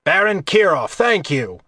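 loud, high theatrical thanks; a bit dramatic. A narrator's reading. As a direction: play it up for onlookers. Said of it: "a bit dramatically".

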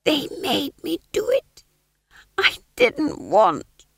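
high, strained whispery hiss; conspiratorial hiss. A narrator's reading. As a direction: plead innocence in a crazed secret hiss. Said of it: "he hissed conspiratorially".

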